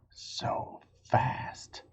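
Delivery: sudden hush on the last words; whispered. A narrator's reading. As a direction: drop to a breathy hush. Whispered line inside letter.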